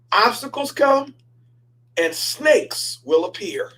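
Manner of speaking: slow, measured sermon cadence; preaching. Acted. Pastor preaching the lesson to the congregation, slow and weighty. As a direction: drive the point home slowly.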